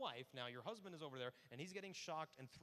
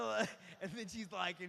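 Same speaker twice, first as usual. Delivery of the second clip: ordinary delivery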